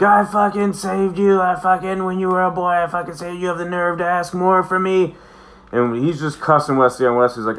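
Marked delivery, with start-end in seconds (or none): 0.0-5.2 put-on drunken furious rant. Drunk Picard cussing out Wesley, high strained fury. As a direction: tear into him for asking again.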